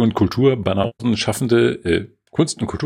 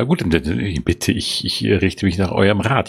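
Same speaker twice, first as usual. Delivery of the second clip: ordinary talk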